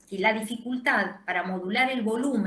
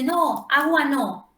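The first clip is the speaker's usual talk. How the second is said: put-on louder, higher, drawn-out child's refusal; imitated child protesting; 5 semitones higher, 6 dB louder, slower than usual. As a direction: protest slowly and loudly like a boy refusing. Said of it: "él dice". (imitando la protesta de un niño)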